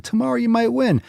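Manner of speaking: high, sing-out cry; mock whine. Streamer teases losers about tomorrow, high mock whine. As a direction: wail it up high in mockery. Same words, high wailing take.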